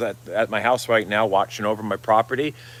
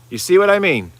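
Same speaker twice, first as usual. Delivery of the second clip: higher and louder insistence; emphatic repeat; 8 semitones higher, 6 dB louder than usual. Real, not acted. Urging viewers to agree, higher and louder insistence. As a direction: push the question hard at them. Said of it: "now you see what I mean?".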